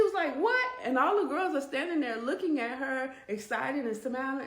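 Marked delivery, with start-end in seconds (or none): 0.4-0.7 high, loud cry of disbelief; shocked. Retold or imitated. Woman hearing she must pay for everybody, shocked. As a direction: blurt it out, stunned.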